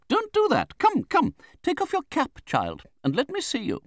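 very high, loud, brisk orders; Betsy Trotwood. A narrator's reading. Aunt orders the weeping widow, bossy. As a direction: order her about like an imperious aunt.

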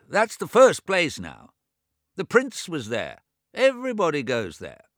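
put-on high, loud old man raising his voice over deafness; a fussy deaf old man talking too loud; deaf old brother. Deaf old James to his bedridden twin, high and loud. James urges another doctor.

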